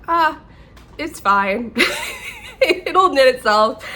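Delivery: high, loud "ah" tipping into laughter; breezy dismissal. Retold or imitated. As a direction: laughingly shrug it off, mock carefree. Brushing off her own unfinished project.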